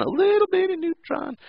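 put-on very high baby-talk squeak; playful high-pitched cutesy voice; teeny-tiny voice. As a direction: shrink the voice to something minuscule. Voices how tiny neutron is.

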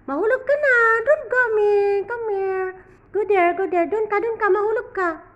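high, loud playful scolding; high excited pet talk. Acted. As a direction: tease the kitten in a high voice.

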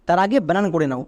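normal talking voice

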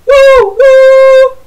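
high loud woo woo; train whistle. Shown as a demonstration. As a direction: hoot like a steam train whistle. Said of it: "Mountain train".

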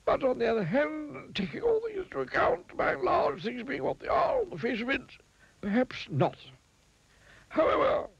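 very high, rambling wizard voice; wordy wizard. A narrator's reading. Wizard weighing up the miser's plea, wordy. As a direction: ramble on pompously, pitched very high. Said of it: "continued the wordy wizard".

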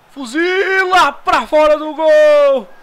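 loud slow call. Acted. Player narrating his own shot.

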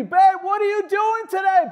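wife's question pitched high and loud, hammed up; high mock wife. Wife asking Noah after seventy years, high mock call. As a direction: play the weary wife calling out. Year seventy skit, raised.

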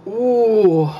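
high, loud sour ooh. Real, not acted. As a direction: react to a sour taste. Sour candy hits.

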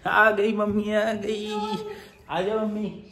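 put-on high, sing-song voice for a toddler; baby talk. Father telling his toddler that mum is home, in high cooing baby talk. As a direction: coo it like talking to a baby.

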